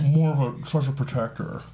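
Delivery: a rough, lightly done Nick Cage voice; Nick Cage impression. Host trying Nick Cage for the chat, lightly imitated. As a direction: toss off a half-hearted Nicolas Cage impression. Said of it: "I can't do Nick Cage".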